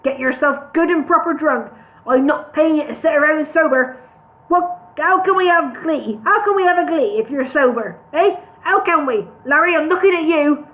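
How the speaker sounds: bully them into drinking; boss rant. Acted. Brewery boss hectoring his sober workers, loud.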